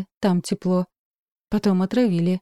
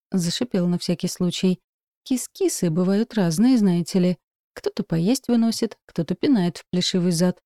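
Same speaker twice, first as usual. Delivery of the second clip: plain reading voice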